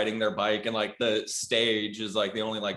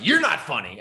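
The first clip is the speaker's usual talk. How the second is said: mock retort; 5 semitones higher, 6 dB louder than usual. Retort to the kid.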